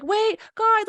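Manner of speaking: put-on high, quick little Australian accent. Imitating a DJ halting her set, in an Australian accent. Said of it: "in her little Australian accent".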